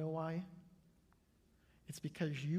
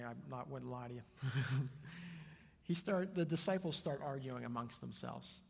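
usual speaking voice

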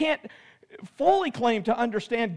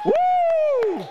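very high loud woo; high cheering whoop; 6 dB louder than usual. Real, not acted. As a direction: whoop at the top of the voice. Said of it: "We're gonna cheer".

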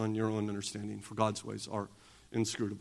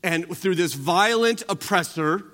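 raised pulpit voice; preaching at full force; 4 semitones higher, 4 dB louder than usual. Acted. Pastor preaching on Paul quoting Habakkuk, full force. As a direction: lift it up and proclaim.

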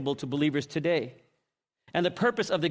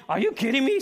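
incredulous; 6 semitones higher than usual. Acted. Ananias balks at God's order.

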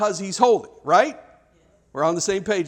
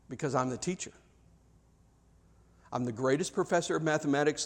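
soft, low and encouraging; calm a nervous student kindly; gentle reassuring teacher; 4 semitones lower, 6 dB quieter than usual. Shown as a demonstration. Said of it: "but he says it like this".

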